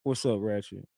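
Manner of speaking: flat and low. Retold or imitated. Rain answering the call strangely, low and soft. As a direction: drop the energy, low and quiet. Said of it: "Like that".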